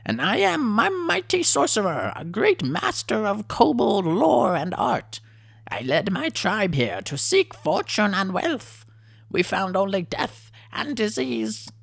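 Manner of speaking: squeaky little chief puffing himself up; kobold chieftain. A narrator's reading. Kobold chief introducing himself to the adventurers, high and pompous. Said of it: "said the kobold with the helmet".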